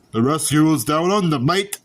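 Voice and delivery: hammed-up Aussie accent with a tossed-off "mate"; bad Australian impression, played for laughs; cheesy Aussie movie-title read; terrible Australian accent. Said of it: "Sorry for my terrible Australian accent".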